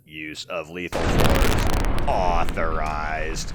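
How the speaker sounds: slow, loud robotic announcement; Protectron robot voice. Retold or imitated. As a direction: say it like a stern security robot. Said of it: "where the Protectron says".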